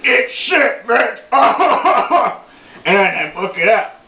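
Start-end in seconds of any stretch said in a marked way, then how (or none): 0.0-1.2 high taunt breaking into a laugh; high, laughing jeer; gleeful taunt. Retold or imitated. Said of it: "And I'm saying". 1.2-2.4 high loud cackling laugh; triumphant high laugh; gleeful cackle. Real, not acted. Triumphant after the hit.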